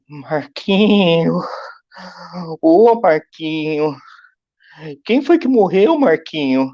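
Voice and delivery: high, drawn-out anxious aunt voice; worried aunt. Retold or imitated. Aunt on the phone fearing his father died, high and anxious. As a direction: call his name slowly like a worried aunt.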